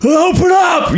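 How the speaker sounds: high, shouted mad doctor; a wild-eyed doctor yelling; mad scientist yell. Retold or imitated. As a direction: bark it like a crazed scientist.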